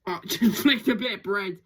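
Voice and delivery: chuckling through the words; amused giggle; laughing. Real, not acted. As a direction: laugh as you tell it. Laughing at bird flicking bread.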